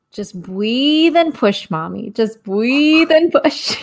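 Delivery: slow, lightly voiced little boy; mild child voice, very slow; slow child coaching. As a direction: coach Mommy slowly like a tiny midwife. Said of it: "And then he says".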